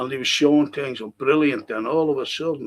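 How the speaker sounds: ordinary delivery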